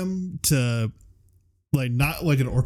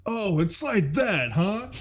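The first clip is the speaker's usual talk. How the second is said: high, drawn-out mock indignation; slow, swooping movie quote; Robotnik; 7 semitones higher, slower than usual. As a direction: stretch it out in a high put-on villain voice. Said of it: "Robotnik is just like".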